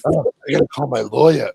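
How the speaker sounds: lightly voiced flustered character; a hint of the movie character's panic. Retelling the repeated joke, lightly voiced. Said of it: "they did that stupid joke twice".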